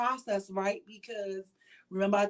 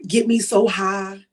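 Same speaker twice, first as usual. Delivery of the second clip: drawn-out louder prayer; prayerful cry; 8 dB louder, slower than usual. Shown as a demonstration. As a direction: call it out slowly like a prayer. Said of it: "when I say that".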